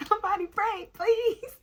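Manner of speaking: high, loud, pleading mock wail; imitating a desperate high yell; retold scream. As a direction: beg for prayer in a hammed-up cry. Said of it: "screaming at them".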